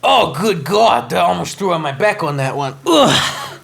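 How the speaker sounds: breaking up laughing; can't hold the laugh in. Real, not acted. Laughing fit after name.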